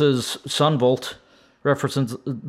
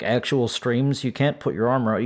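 usual speaking voice

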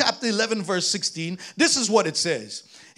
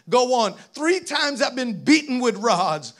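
usual speaking voice

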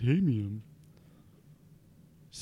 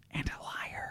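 whispered jab; whispered aside; 15 dB quieter than usual. Real, not acted. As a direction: slip it in under breath.

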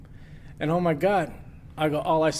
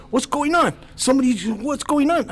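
higher, urgent questioning; brother alarmed; 8 semitones higher than usual. Retold or imitated. Brother flipping on the lights, urgent high question. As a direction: demand to know what happened. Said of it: "He goes".

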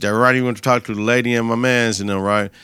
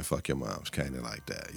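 much softer, lower mutter; muttered disapproval; 11 semitones lower, 18 dB quieter than usual. Real, not acted. Vlogger disgusted at friends insulting his mom, muttered. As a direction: drop it to a near mutter. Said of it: "That shit ain't cool".

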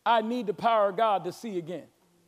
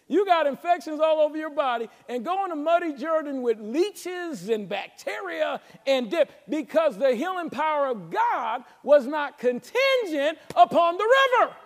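impassioned preaching build; 5 semitones higher, 3 dB louder than usual. Real, not acted. Pastor on healing in the muddy Jordan, building preacher's cry. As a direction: stretch key words, rising to a high, loud cry.

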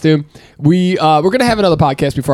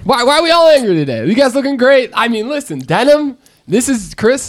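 high, upbeat teasing; playful excitement; 8 semitones higher than usual. Real, not acted. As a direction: hype them up. Playfully cheering up friends.

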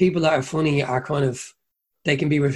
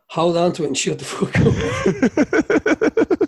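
much higher, slow mock drawl; scornful pub local; 11 semitones higher, much slower than usual. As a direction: lean on each word to shut the joker down. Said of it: "And they go".

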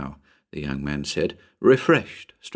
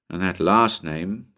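plain reading voice

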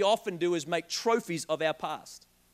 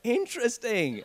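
slow, put-on eager interest; mock fascination; 2 semitones higher, slower than usual. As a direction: pretend to be enthralled, laying it on. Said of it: "I'm like".

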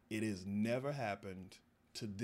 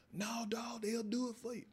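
high whispered bro urging; mock homie; 13 semitones higher than usual. Friend pushing him to get a grill, high whisper. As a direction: coax him like a pushy buddy.

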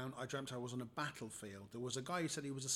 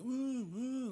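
high, loud wounded "ooh"s; mock moans of pain; distressed moaning; 11 semitones higher, 5 dB louder than usual. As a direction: imitate a casualty groaning in distress. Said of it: "a little bit upset and a bit distressed".

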